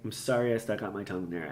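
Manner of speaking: usual speaking voice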